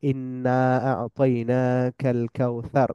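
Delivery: very slow recited Arabic; slow tajweed recitation; Quranic recitation. Shown as a demonstration. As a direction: recite it slowly, drawing out each sound. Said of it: "Surah Kawsar".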